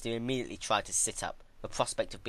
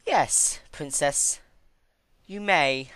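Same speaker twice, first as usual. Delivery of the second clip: higher, louder, condescending deal; Twilight bargaining; 7 semitones higher, 7 dB louder, much slower than usual. Acted. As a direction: talk down to her like a governess. Twilight granting permission.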